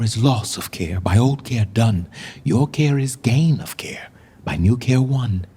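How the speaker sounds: low hushed bitterness; bitter whisper. Acted. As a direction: whisper the riddle at him. Bitter wordplay to Bolingbroke.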